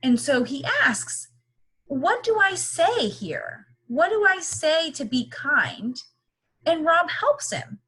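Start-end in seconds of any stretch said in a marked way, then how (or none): 1.9-6.0 higher, puzzled robot voice; voicing Bot. Teacher voices Bot asking what to say, high and puzzled. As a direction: ask it like the unsure little robot.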